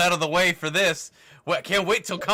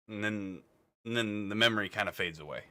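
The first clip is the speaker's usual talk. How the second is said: fading memory; 5 semitones lower, 7 dB quieter than usual. A narrator's reading.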